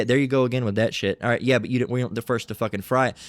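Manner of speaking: ordinary delivery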